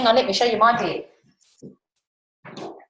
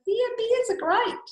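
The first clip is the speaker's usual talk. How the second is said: high mock voice; 14 semitones higher, slower than usual. Voicing someone praising bears.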